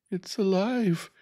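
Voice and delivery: very high, drawn-out cry; high-pitched horrified scream, moderately loud; screamed in horror. A narrator's reading. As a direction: shriek it in terror, slowly. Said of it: "she screamed".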